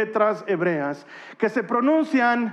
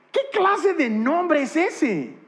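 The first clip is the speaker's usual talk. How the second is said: incredulous; 7 semitones higher, much slower than usual. Real, not acted. Mocking the strange name.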